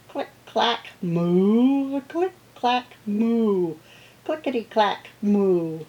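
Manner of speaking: crisp typewriter clicks, then long loud moos; typing cows. Acted. Farmer hears cows at the typewriter, typing sounds and moos. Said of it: "All day long he hears".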